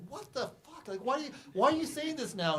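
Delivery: high-pitched imitation of upset disbelief; retold exasperated outburst; incredulous. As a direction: act out her baffled protest, pitch leaping up. Acting out actress's shocked reaction to absurdity.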